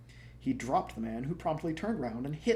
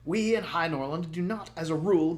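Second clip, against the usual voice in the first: royal rebuke; 5 semitones higher, 7 dB louder than usual. Acted. King rebuking the prince.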